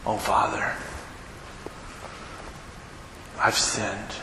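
soft, low, broken confession; contrite son. Acted. Son confessing to his father on his return, hushed and contrite. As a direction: hang your head and say it quietly.

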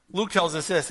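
usual speaking voice

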